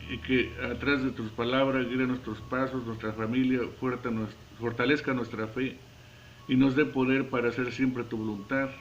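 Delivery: soft prayer voice, fading out on the amen; closing prayer. Acted. Preacher leading the congregation in prayer, softly reverent. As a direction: pray humbly, letting it sink toward the amen.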